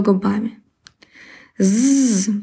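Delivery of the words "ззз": bee buzz. Acted. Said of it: "прожужжала обиженная пчела".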